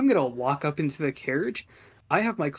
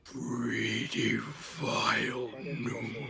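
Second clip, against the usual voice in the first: slow, low demonic voice; 3 semitones lower, 5 dB quieter, slower than usual. Acted. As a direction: speak as a demon through your patron. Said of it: "speak in my demonic voice".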